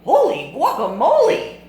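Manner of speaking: high, loud surprised yelp; startled exclamation. Acted. Kitten sees himself in the mirror, loud startled high cry. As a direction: blurt it out in shock.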